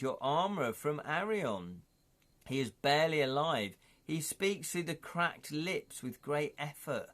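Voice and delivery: normal talking voice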